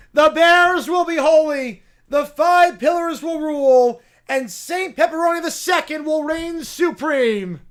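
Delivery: high, loud zealot sermon to the rats; rally the rodents like a mad prophet; preaching bear. Acted. Bear's final proclamation.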